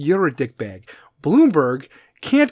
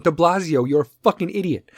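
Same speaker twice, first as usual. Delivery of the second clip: ordinary delivery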